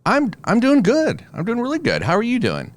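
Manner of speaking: upbeat hello; cheerful greeting. Retold or imitated. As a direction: beam it out.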